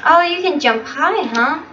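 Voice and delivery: usual speaking voice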